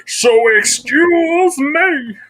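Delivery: hammed-up comic movie impression, climbing to a high, drawn-out finish; Billy Madison. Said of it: "Adam Sandler and the Penguin".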